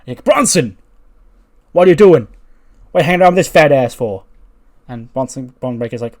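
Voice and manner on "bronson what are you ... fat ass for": loud, high and fast, barking at his nephew; angry yell. Acted. Uncle scolding his nephew for his company: angry yell. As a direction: yell it across the locker room like an outraged uncle.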